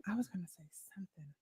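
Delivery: soft whispered aside; whispering. Real, not acted. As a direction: whisper it to yourself. Aside to the stream.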